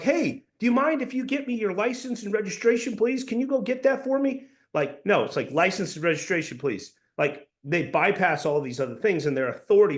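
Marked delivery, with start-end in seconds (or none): 0.0-4.5 very high, over-sweet request; un-coplike polite asking. Shown as a demonstration. Coach mocking a too-polite cop, high and pleading. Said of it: "it's not like". 5.5-6.9 firm authoritative demand, falling at the end; cop tone. Shown as a demonstration. Cop at a traffic stop, authoritative. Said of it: "a cop tone".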